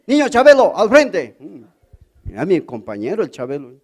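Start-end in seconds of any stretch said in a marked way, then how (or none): 0.1-1.4 loud, higher summons; mock schoolteacher; teacher calling out. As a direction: call him up sharply. Teacher calls pupil to front.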